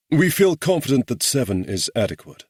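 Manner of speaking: bland, smooth English officer; smooth drawing-room accent. A narrator's reading. Morgan calmly counters the guerrilla chief, smooth drawing-room accent. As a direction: offer it smoothly, unruffled. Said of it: "Morgan offered smoothly in his bland drawing-room accent".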